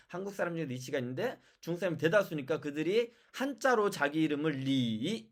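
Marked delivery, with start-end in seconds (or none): 4.5-5.3 리 with the vowel drawn out; slow, lengthened 리; long vowel. Shown as a demonstration. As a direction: stretch 리 into a long "ee". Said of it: "이렇게 장음으로 읽으니까".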